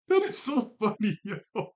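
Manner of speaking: real amused outburst; laughing out loud at the cartoon. Real, not acted. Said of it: "She did not need to write that".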